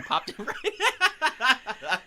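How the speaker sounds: slow words through laughter; laughing too hard to finish. Real, not acted. Laughing while telling fish story.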